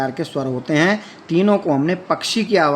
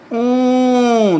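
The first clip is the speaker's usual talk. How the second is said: drawn-out, higher and louder crow; long rooster crow; 7 semitones higher, 3 dB louder than usual. Shown as a demonstration. Teacher crows like a rooster to show a long vowel, held and high. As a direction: stretch the vowel into a full rooster call. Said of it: "अंत में पूरा प्लुत से बोलता है, ज़ोर से बोलता है".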